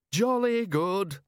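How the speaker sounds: bellowing. A narrator's reading. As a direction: boom it over the crowd. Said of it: "Ridcully bellowed above the buzz".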